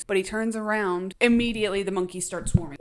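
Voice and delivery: normal talking voice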